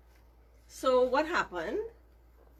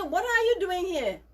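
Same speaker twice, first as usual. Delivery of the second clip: stern mother bird; 9 semitones higher, 5 dB louder than usual. Acted. Ibis mother confronts baby.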